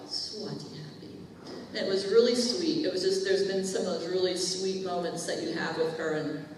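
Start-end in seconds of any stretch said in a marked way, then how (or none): 0.1-1.1 soft unvoiced little girl's words; whispered child quote. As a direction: breathe it like a shy child. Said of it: "her Indian name is Swati".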